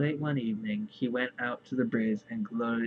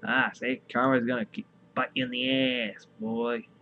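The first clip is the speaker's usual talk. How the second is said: ordinary talk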